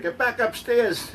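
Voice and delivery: high, loud, drawn-out scolding; parents yelling. Retold or imitated. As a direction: yell it like parents ordering a child away. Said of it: "And then I screamed, and they said".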